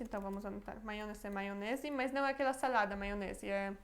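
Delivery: ordinary talk, nothing marked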